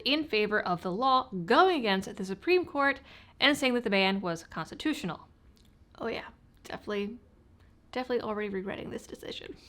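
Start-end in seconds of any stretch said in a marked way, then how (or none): 6.0-9.5 quick rueful whisper under the breath; hurried whisper, half to yourself; whispered aside. Real, not acted. Rueful aside about recording all night.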